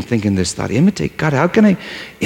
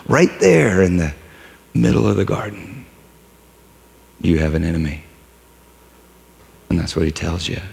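ordinary delivery

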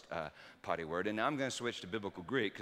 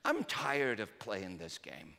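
put-on tired little girl; 3 semitones higher, slower than usual. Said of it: "she said".

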